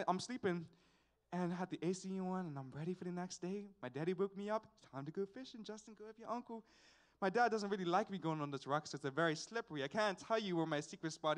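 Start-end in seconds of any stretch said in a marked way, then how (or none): 4.9-6.6 imitated dad, much softer; gently voiced parent rousing a sleeper; soft wake-up call. Dad waking his son to go fishing, soft wake-up call.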